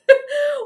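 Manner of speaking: real laugh at a stuck screen; high burst of laughter. Real, not acted. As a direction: break into a helpless laugh. Face stuck on stream.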